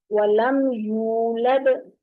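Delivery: वलम यूलद slowly, with a long rounded oo; rounded lips. Shown as a demonstration. Teacher modelling the verse, slow rounded vowel. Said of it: "गोल करेंगे बाकायदा".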